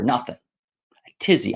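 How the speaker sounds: slow unvoiced whisper of the word; whispered aside. Real, not acted. Whispered aside about his old-fashioned word. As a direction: breathe it out slowly like a secret. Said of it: "I'm old".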